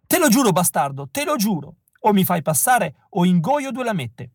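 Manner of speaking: high, pleading threat, voice straining; desperate threat. Acted. Rejected boy swears at the casting man, desperate and angry. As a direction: swear it at him, desperate and furious.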